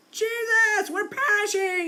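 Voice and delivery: high-pitched, frantic plea for rescue; panicked cry. Retold or imitated. Disciples crying out to Jesus in the storm, high and panicked.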